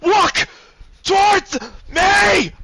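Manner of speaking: high, drawn-out full-force scream; screamed command. Acted. Man ordering her to walk to him, screaming. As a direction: scream each word at the top of your lungs. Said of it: "screaming at the top of his lungs".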